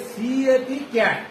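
সিএটি letter by letter, slowly, then ক্যাট whole; spelling out cat. Shown as a demonstration. Teacher models spelling for pupils, slow spelled-out cat. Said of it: "কি বললাম?".

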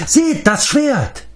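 high, slow, drawn-out call; excited cry. A narrator's reading. Fleiftur urges the companions to look, slow high cry. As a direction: stretch the shout as if pointing. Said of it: "schrie Fleiftur".

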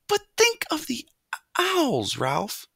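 high-pitched pleading mother mouse; imploring. A narrator's reading. Mother begs son to fear owls, imploring. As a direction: beg him, voice high then dropping on the name. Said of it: "implored his mother".

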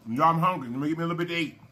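usual speaking voice

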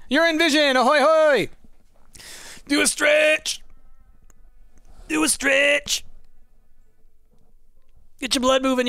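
high put-on cheerleading voice; playful stretch coach. Put-on voice urging viewers to stretch.

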